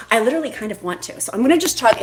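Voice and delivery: ordinary talk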